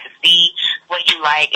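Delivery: usual speaking voice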